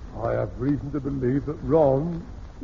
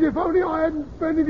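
mock sleep-talker cry; 11 semitones higher than usual. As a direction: imitate the son crying out in his sleep, much higher. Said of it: "he says".

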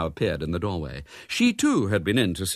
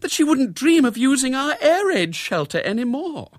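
high, loud lament; high-pitched woe; distressed repetition; 6 dB louder than usual. A narrator's reading. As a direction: repeat the insult in wounded dismay. Upset wife repeats the snub.

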